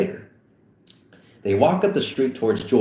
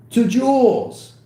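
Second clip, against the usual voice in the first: higher, louder and slow toast; toast shout; 14 semitones higher, 5 dB louder, slower than usual. Acted. Seth toasting the host, shouted high. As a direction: raise the glass and call it out.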